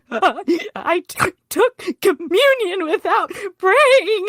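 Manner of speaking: high, loud crying voice, slowing; retelling a breakdown, sobbing; choked sobbing. Retold or imitated. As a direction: sob the words out, choking. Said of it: "I was, like, choking".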